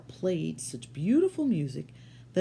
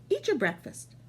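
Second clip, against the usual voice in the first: higher, lightly voiced wife; wife's voice; 7 semitones higher than usual. Wife dismissing the giant, lightly higher voice. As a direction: brush him off, a bit higher. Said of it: "said his wife".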